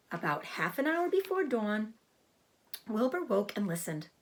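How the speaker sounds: ordinary delivery, nothing marked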